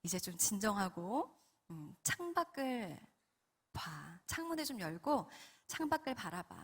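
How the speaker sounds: soft, low, unhurried calming voice; tender, hushed instruction; soothing Holy Spirit. Retold or imitated. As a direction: gently settle someone down, with pauses. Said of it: "성령님께서 또 이런 감동을 주십니다".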